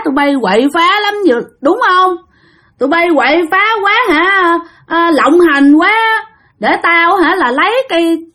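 put-on high-pitched drunken threats; tipsy man ranting. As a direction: challenge the ghosts like a man who's been drinking. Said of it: "hình như ổng xỉn, ổng nói bậy". (giọng ông nhậu xỉn hăm dọa, cao)

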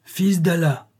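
high, acted call for attention; sorcerer's cry. A narrator's reading. Sorcerer calls out to the sultan, crying out. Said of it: "cria-t-il".

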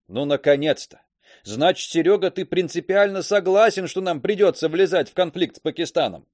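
loud, eager, lively outburst; excited exclamation. Acted. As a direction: exclaim it with relief. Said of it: "Виктор оживился и воскликнул". (радостный возглас)

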